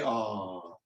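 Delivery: low, soft, drawn-out coo; sympathetic aww. Shown as a demonstration. Performer cueing everybody to coo, sympathetic aww. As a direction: lead the crowd in a falling aww. Cues volunteer's sympathetic sigh.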